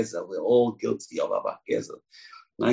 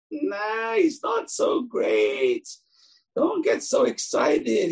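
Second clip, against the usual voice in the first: much higher, slow, half-shouted brush-off; mock dismissive rabbi; much slower than usual. Rabbi voiced dismissing praise.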